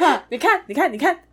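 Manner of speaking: loud teasing told-you-so; mock triumphant taunt; gloating. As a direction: rub it in to dad. Gloating to dad.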